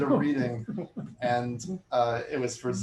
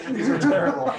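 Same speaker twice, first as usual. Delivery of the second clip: laughing mock complaint, pitched up; 9 dB louder than usual. Real, not acted. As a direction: laugh through the joke about the chairs. Joking about the chairs.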